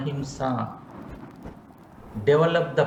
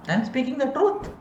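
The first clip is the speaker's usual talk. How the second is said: mock self-justifying; 8 semitones higher, faster than usual. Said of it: "Can we say like that to the patient?".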